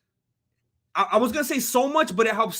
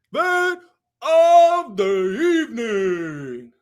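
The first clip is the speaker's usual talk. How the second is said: mock announcer; 4 dB louder, much slower than usual. Announcer-style call.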